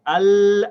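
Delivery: AL high and loud with a held l; connected lam. Shown as a demonstration. Teacher connecting alif to lam, l held. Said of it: "connect the alif to the lam".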